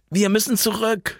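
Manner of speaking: high-pitched narrated shout at moderate volume; high, pressing cry; urgent shout. A narrator's reading. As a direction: cry out in alarm, held back in volume. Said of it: "schrie Jan".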